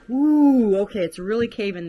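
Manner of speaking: loud, high, fast alarmed cry. Real, not acted. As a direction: call it out as the floor gives. Floor caving under her feet.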